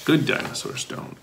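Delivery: soft confiding whisper; hushed, gentle reassurance to kids. Pretend. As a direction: whisper it like a secret rule. Gently correcting the children.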